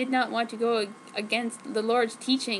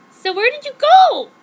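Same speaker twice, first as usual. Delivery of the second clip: much higher and louder would-be seductive question; trying to sound hot; 14 semitones higher, 12 dB louder than usual. Acted. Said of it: "trying to sound hot as possible".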